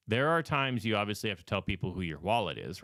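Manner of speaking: usual speaking voice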